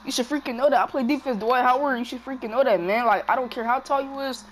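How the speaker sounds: loud, high taunting at the screen; trash talk. Real, not acted. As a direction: yell it at the player, mocking. Trash talking the game player.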